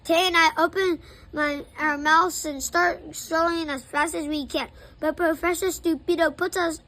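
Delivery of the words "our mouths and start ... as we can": ordinary delivery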